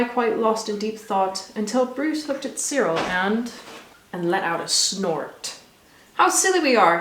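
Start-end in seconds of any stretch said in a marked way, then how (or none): 6.1-7.0 proclaiming. A narrator's reading. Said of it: "he proclaimed".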